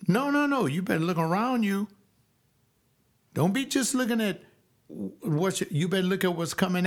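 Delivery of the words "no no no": high, insistent no no no; pitched-up protesting no; emphatic rejection. Real, not acted. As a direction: shut the idea down hard. Rejecting the quoted advice.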